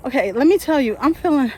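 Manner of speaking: high, loud and breathless; panicky. Real, not acted. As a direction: close to hyperventilating. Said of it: "I'm starting to hyperventilate".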